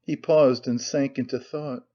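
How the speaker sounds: plain reading voice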